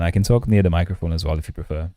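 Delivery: playful mock ASMR, mic held close; jokey close-mic streamer voice. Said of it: "Using your voice as A S M R content".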